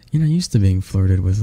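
ordinary delivery

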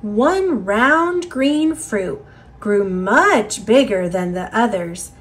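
ordinary delivery, nothing marked

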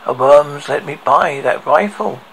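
plain reading voice

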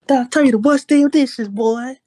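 high, loud mom scolding; hammed-up angry mom voice; mock scolding mom. Acting out his yelling mother.

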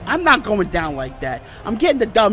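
usual speaking voice

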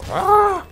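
loud, high mock dwarf war cry; Gimli's battle yell. Gamer imitating the dwarf's jump sound, loud high yell. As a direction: belt out a dwarf's axe-swing yell. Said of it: "This voice, what he makes when he goes".

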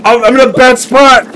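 loud, high-pitched panic; panicked shouting. Real, not acted. Player hit and stuck in game, panicked shouting. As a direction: yell frantically as things go wrong.

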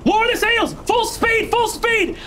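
high captain's orders over the storm; shouting orders. Acted. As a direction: bark the orders far up in pitch. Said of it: "Annabelle starts to shout".

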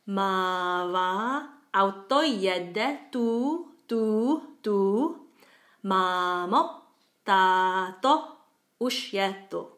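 slow, measured recital with exaggerated long vowels; Czech nursery rhyme. Shown as a demonstration. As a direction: chant it for learners, stretching long syllables, short ones cut short. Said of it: "it goes something like this".

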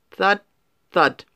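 ordinary delivery

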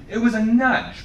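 high, loud protest; indignant yelp of protest. Acted. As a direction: Sam protesting loudly to the mother. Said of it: "protested Sam".

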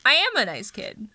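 talking with cheeks squished. Pretend. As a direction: speak through squeezed cheeks. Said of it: "Squish my cheeks".

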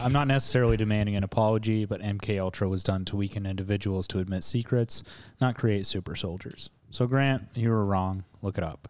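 low, soft, gentle reading. Shown as a demonstration. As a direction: read the letter gently, easing down softly. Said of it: "read that again in a more, like, gentle tone".

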